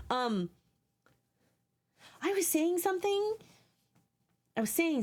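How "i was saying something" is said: much higher pitch, held up throughout; high-pitched musing. Real, not acted. Trying to find her lost thread, high-pitched musing. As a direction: pitch the whole phrase way up.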